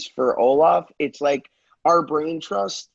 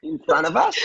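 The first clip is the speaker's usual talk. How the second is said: put-on Australian accent with a much higher rising end; Megan's Australian accent, pitched way up on the question; imitating Megan's Australian accent; 12 semitones higher than usual. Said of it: "You nailed that accent, [name]".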